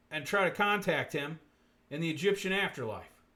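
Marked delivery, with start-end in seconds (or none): none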